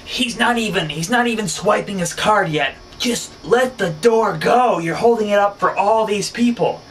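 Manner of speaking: subway passenger yelling at a woman holding the door, high and loud; imitated high-pitched yelling. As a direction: yell it way up high like the angry rider. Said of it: "his voice was, like, high-pitched, and he was just yelling".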